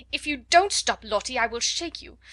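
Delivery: flustered teacher scolding sharply; exasperated threat. A narrator's reading. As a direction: lose patience with the child. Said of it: "then in quite another tone".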